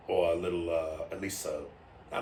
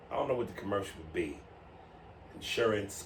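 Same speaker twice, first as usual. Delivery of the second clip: usual speaking voice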